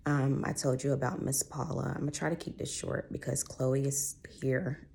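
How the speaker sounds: usual speaking voice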